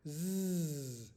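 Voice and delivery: a held buzzing zzz; buzzing z sound. Shown as a demonstration. Teacher modeling the zzz sound for spelling. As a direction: buzz the zzz like a bee. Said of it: "Sound one is".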